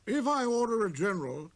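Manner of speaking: high, loud regal summons; proud king summoning a visitor; king's command. Acted. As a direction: call him closer with pride. Voices the king.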